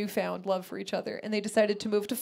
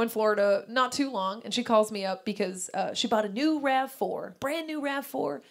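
ordinary talk, nothing marked

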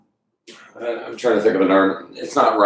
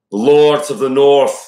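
loud, drawn-out Northern English accent; 7 semitones higher, 6 dB louder, slower than usual. Shown as a demonstration. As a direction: put on a broad North of England accent, high and slow. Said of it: "This is the Northern".

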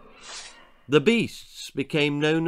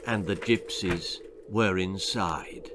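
ordinary delivery

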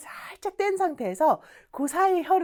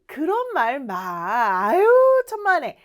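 rising into loud, high protest; effusive acted modesty; friendly brush-off; 6 semitones higher, 6 dB louder than usual. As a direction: wave away thanks with a big "aw, come on". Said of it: "약간 이런 느낌이에요".

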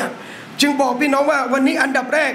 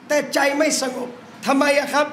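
ordinary delivery

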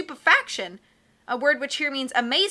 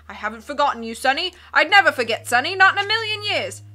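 loud, hearty captain voice; blustery sea captain; 5 dB louder than usual. Acted. Captain puzzling over charts, loud and hearty. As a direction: boom like a cheerful old sea dog.

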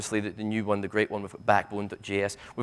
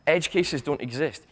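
ordinary delivery

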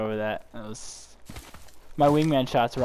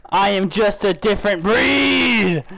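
excited shout; 8 semitones higher, 8 dB louder, much slower than usual. Real, not acted. Repeats hype line, drawn out.

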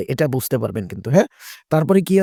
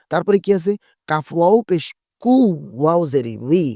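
ordinary talk, nothing marked

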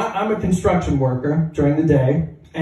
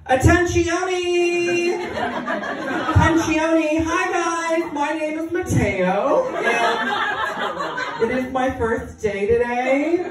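high, slow, sing-out announcement; put-on high voice, vowels stretched; prissy newcomer Mateo; 10 semitones higher, much slower than usual. New guy to hardened crew from atop lunchbox, chirpy. Prank persona addresses construction crew.

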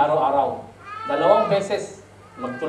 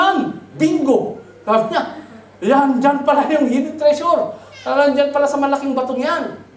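higher and louder, thrilled to find it; excited discovery; 8 semitones higher, 5 dB louder than usual. Acted. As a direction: cry out bingo at the big rock. Man finds the treasure rock. (masiglang sigaw ng pagkatuklas)

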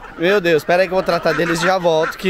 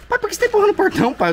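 exasperated; much faster than usual. Real, not acted. Daughter scolding father at gate.

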